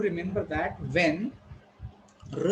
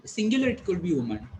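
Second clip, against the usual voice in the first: normal talking voice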